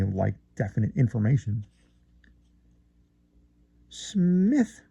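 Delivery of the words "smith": lightly drawled name with a rise; drawling. Acted. Friend greeting narrator in his drawling way. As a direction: stretch the syllables lazily. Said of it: "drawling out syllables".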